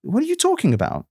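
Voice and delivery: high, incredulous demand; as if going insane at the nonsense; exasperated disbelief. Acted. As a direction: pitch the question up in bafflement. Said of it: "feel like I'm going insane".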